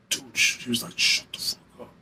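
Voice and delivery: urgent whispered shushing, imitated; barely voiced warning to shut up; hushing whisper. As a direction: whisper it so the others can't hear. Friend secretly hushing him at dinner.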